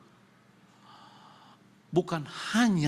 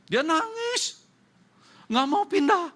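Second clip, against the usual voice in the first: much higher, loud wailing pitch; mock crying whine; 7 dB louder, slower than usual. Preacher mimics the tearful maid refusing to leave, high whine. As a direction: squeal it like a weeping maid.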